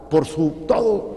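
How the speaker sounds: ordinary delivery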